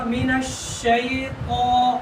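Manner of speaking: "من الشیطان" chanted with a thick, heavy "taa"; heavy, full sound. Shown as a demonstration. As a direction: draw out "الشیطان" and round the final vowel heavily. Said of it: "موٹا کرو".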